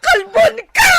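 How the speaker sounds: high, loud crying shout; angry shout. Acted. Wife screaming insults during the fight, loud and tearful.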